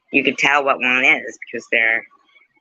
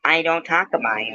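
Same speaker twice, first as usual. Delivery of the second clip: ordinary talk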